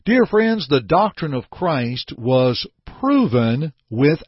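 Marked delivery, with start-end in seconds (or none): 0.1-0.7 lifted, fervent call to the congregation; preaching. Acted. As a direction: call out to the flock with fervour. Preacher's raised address.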